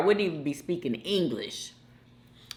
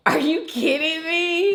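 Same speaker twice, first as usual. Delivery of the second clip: surprised disbelief; 9 semitones higher than usual. Real, not acted. Reacting to reading in closet.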